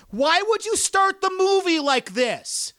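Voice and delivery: high, slow, drawn-out mock yell; retold yell. Imitating the girl yelling at the screen, high and drawn out. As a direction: stretch out an outraged cry. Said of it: "she yells it like this".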